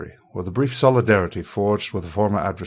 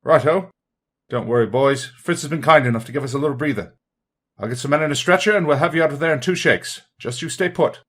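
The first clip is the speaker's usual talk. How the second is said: raised, high-pitched calling voice; gruff British bellow; 6 semitones higher, 3 dB louder than usual. Acted. Rescuer reassures the stranded soldiers, calling out gruffly. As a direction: call hearty reassurance across a distance. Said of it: "a gruff British voice bellows".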